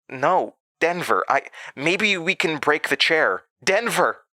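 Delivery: growing panic, then crying out his name; rising panic. A narrator's reading. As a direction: call his name as fear builds. Sam panicking, calling Denver.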